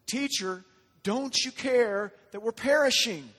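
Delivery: desperate plea. Acted. Disciples waking Jesus as they fear drowning, desperate plea.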